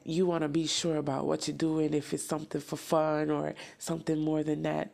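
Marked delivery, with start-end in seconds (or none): none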